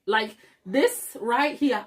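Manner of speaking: ordinary delivery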